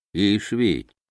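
ordinary delivery

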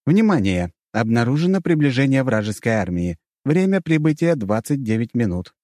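urgent public-address announcer; loudspeaker alert voice. A narrator's reading. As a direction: announce an incoming attack over speakers. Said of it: "раздался голос из динамиков".

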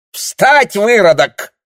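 very high, loud shriek of command; screaming order. Acted. She kicks him and orders. (пронзительный громкий приказ)

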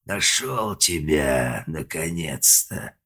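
slow, otherworldly demon voice; demonic yell. A narrator's reading. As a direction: drag each word out like a demon from hell. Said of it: "а потом заорал".

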